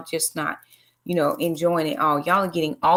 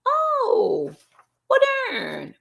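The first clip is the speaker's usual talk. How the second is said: surprised; much slower than usual. Real, not acted. Realises she got the day wrong.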